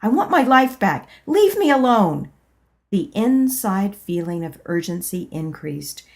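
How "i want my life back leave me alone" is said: angry refusal. Acted. Angrily refusing the inner voice.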